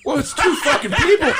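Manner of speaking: high, loud laughing outburst; laughing shout. Real, not acted. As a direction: burst out laughing at the joke. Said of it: "laughing shout".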